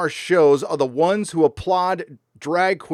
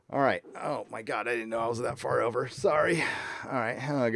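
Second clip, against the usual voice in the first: ordinary talk, nothing marked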